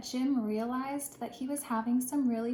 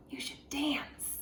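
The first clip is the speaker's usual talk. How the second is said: slow whisper; 3 semitones higher, slower than usual. Acted. Animals advising Jim.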